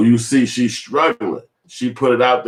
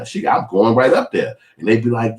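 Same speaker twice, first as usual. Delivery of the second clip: ordinary delivery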